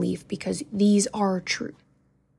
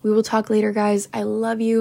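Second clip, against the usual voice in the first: ordinary talk, nothing marked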